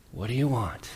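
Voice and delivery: one possible tone. Shown as a demonstration. Jesus turning to the two followers, softly muttered. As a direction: ask it under your breath, softly. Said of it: "who knows what the tone was".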